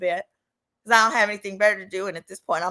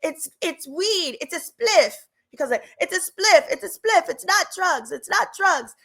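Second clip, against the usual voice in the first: higher, slow, insisting it's only weed; mock defensive boyfriend; 8 semitones higher, 3 dB louder, slower than usual. Boyfriend denying drugs, higher defensive insistence. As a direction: protest too much, repeating yourself. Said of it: "He was like".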